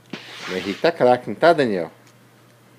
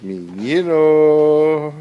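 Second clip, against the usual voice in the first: drawn-out, slightly raised call; long sing-out to a little one; playful calling; 4 semitones higher, 4 dB louder, much slower than usual. Real, not acted. Calls playfully to the little boy.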